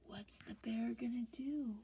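hushed, suspenseful question; whisper. Real, not acted.

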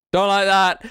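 usual speaking voice

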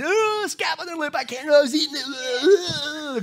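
high, loud whiny disgust; mock disgusted Carly. Preacher voicing Carly's horror at the scab, high and loud. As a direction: squeal it like she's about to gag.

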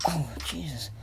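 usual speaking voice

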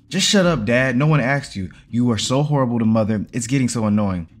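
heated outburst, easing slightly as it goes; angry shout. A narrator's reading. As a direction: shout back at your father, loud from the first word. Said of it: "you shouted at him".

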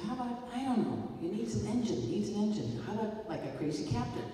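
editor's bright idea. Retold or imitated. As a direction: pitch it up like a sudden eager suggestion. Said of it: "So he said".

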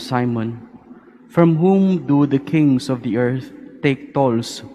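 formal liturgical reading voice; Gospel reading. Acted. Priest proclaims the Gospel reading.

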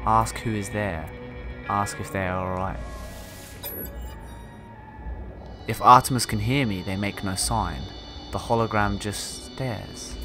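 calm reading of a log; story narration. Acted. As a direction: read it like a captain's journal. Reads game log aloud.

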